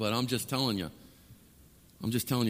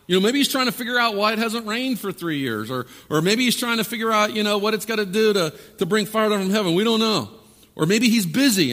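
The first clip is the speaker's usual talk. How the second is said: high-pitched mock speculation; passive-aggressive taunt; 7 semitones higher, 4 dB louder than usual. Elijah mocking the prophets' silent god, taunting. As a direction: pile up mock excuses for their god. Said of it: "the epitome of passive aggressiveness".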